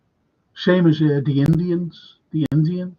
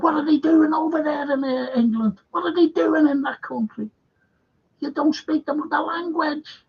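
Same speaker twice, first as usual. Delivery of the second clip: much higher put-on complaining voice; mimicked indignant complaint; 12 semitones higher than usual. Retelling Luigi's grumble about people abroad, high mock indignation. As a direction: mimic an indignant Italian far up in pitch.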